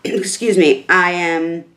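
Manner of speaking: ordinary talk, nothing marked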